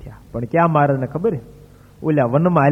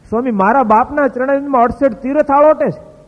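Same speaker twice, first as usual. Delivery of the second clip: much higher, louder astonished question; a boy's stunned, raised question; amazed disbelief; 10 semitones higher, 5 dB louder than usual. Retold or imitated. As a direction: cry out in wonder at the news. Boy amazed at swami's answer.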